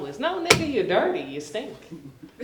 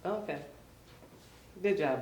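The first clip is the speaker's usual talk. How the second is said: ordinary delivery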